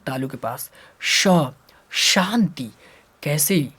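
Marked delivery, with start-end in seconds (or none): 1.0-2.7 श as a clear "sh", tongue toward the palate; शांति begun with a distinct hushing "sh"; palatal श demo. Shown as a demonstration. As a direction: sound out श, then शांति. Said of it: "जीभ को तालु के पास लेके जाते हैं".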